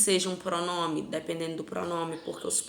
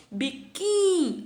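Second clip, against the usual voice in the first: biquíni slowed, higher and louder on the final ni; slow, loud, higher demo of biquíni ending on ni; last syllable pointed out; 10 semitones higher, 6 dB louder, much slower than usual. Shown as a demonstration. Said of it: "Aqui seria a minha última sílaba".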